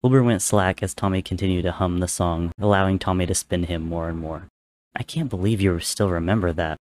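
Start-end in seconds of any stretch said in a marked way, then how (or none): none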